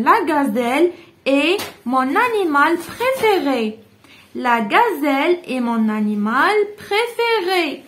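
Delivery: slow, stretched read of "La gazelle est mon animal préféré."; slow model answer. Shown as a demonstration. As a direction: read the final answer out clearly and slowly. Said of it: "teacher models final answer".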